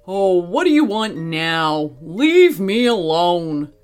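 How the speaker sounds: mildly rude gorilla, slowing at the end. Acted. Gorilla to arriving parrot, rude. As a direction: drag out the dismissal rudely. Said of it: "rather rudely".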